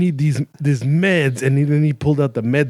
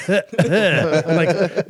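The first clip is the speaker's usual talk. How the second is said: laughing; 4 semitones higher than usual. Real, not acted. Laughing while telling the story.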